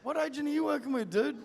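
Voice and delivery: high, fast, loud disbelief; mock outrage. Real, not acted. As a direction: blurt it out in disbelief.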